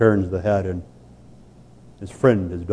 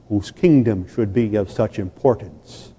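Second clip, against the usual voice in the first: usual speaking voice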